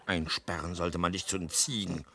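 very low, soft grumble; disgusted low muttering; contemptuous father. Acted. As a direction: scold a hopeless son under your breath. Father scolding useless son.

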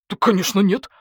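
mildly indignant wolf; indignant denial. A narrator's reading. Wolf answers the question about witnesses, mildly indignant. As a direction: protest as if it were obvious. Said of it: "возмутился волк".

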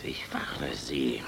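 low, slow threatening whisper; menacing whisper. Acted. General warning the woman in a low, slow whisper. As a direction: drop to a deadly whisper.

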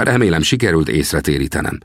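ordinary delivery

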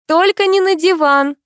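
high, loud sudden cry; loud warning. A narrator's reading. Heroine stops Alex from sitting, crying out high. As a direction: call out to stop him. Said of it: "добавила громко".

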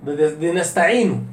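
usual speaking voice

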